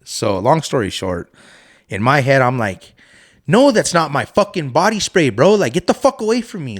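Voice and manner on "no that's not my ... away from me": irritated retort. Retold or imitated. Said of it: "I got pissed finally".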